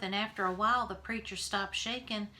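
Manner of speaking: ordinary delivery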